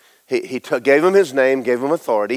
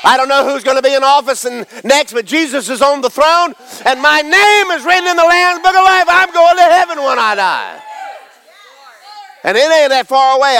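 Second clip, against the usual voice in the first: higher, louder building sermon shout; preaching at full force; 9 semitones higher, 3 dB louder than usual. Real, not acted. Preacher proclaiming heaven to the congregation, full force. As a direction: preach it with rising fire.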